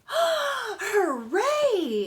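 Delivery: high, loud joyful kitten shout; excited cheer. A narrator's reading. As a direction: cheer like a delighted little kitten. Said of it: "exclaimed the kittens".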